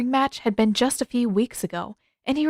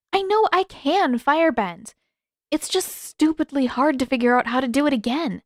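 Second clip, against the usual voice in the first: put-on higher girl's voice, insistent; stubborn young waterbender pleading her case; higher eager insistent voice; Katara, frustrated; 7 semitones higher than usual.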